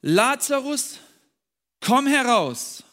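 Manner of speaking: Lazarus called out high and loud, slow. Acted. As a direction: summon him from the tomb with a loud cry. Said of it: "rief er mit lauter Stimme".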